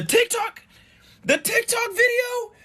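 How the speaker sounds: rising to a very high shriek; sputter, then squeal in disbelief; stunned, high-pitched questioning; incredulous outcry. Real, not acted. Host disbelieves reason for returning.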